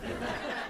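weak, quiet rasping purr; sickly cat growl. Shown as a demonstration. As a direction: feeble growl like an ailing cat. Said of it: "like a, a cat with leukemia".

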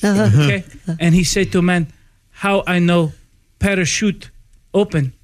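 put-on halting joke in a foreign accent; deadpan accented broken English. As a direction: tell it in short phrases with an imitated accent. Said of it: "in broken English".

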